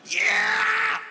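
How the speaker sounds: high, drawn-out shout; sudden yell. Real, not acted. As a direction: let out a long whooping cry.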